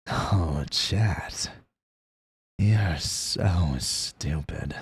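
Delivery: low, slow, sneering villain; smug anime villain. Retold or imitated. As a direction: drawl it out, looking down on them. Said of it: "people want me to be the more".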